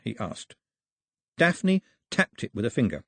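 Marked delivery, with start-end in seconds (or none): none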